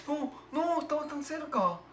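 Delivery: high, wailing disappointment; dismayed lament. Real, not acted. Player who was so close, high dismayed wail. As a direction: lament the near miss in a high voice. Just missed.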